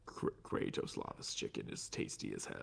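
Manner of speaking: low, breathy sinister voice; low villain voice. Acted. Repeating line in villain voice.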